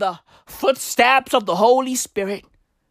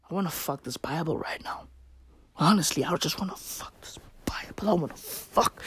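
mock lustful whisper; 9 semitones lower, 12 dB quieter than usual. Voicing Chris Pratt's secret urge.

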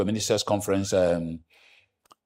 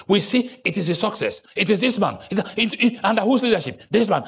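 ordinary delivery

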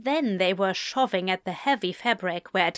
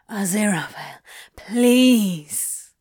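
panting plea; 6 dB louder, slower than usual. Acted. Crowley panting, begging.

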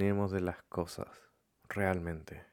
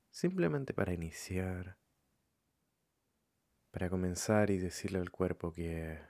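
calm, softened meditation voice with long pauses; guided meditation; 4 dB quieter than usual. Acted. Leading listeners to follow their breathing, calm meditation voice. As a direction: guide them gently, leaving room between phrases.

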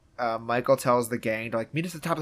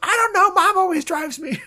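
high, raised-voice mock panic; imitated fluster; 4 dB louder than usual. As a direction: blurt it high, playing a flustered kid. Retold flustered reply of boy.

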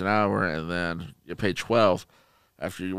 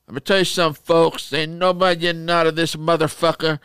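loud, higher mobster-style order; mob-boss manager; 6 semitones higher, 4 dB louder than usual. Retold or imitated. Manager ordering the team to stay late, mob-boss style. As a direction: lay down the law like a mob boss. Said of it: "They ran out like the mob".

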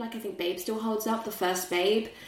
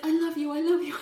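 gushing dog; 7 semitones higher, faster than usual. Retold or imitated. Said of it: "he's like".

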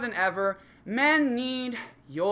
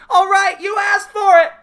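much higher and louder threat; threatening shout; 13 semitones higher, 15 dB louder than usual. Acted. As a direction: warn him before you strike. Girlfriend threatening in skit.

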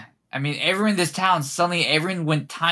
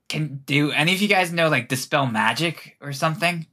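ordinary talk

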